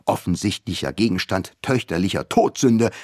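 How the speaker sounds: usual speaking voice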